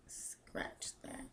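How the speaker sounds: barely audible whisper to self; whispered self-correction. Real, not acted. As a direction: whisper it as you rethink. Correcting herself while thinking.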